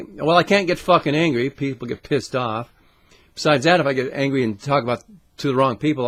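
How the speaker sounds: ordinary talk, nothing marked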